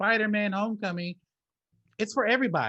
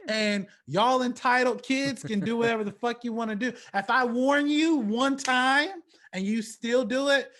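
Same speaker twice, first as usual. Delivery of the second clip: louder, higher mock tirade as an unbothered Willy Wonka; imitating brash indifference; 7 semitones higher, 5 dB louder than usual. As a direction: lay down the law to entitled kids, high and emphatic. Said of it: "He was like".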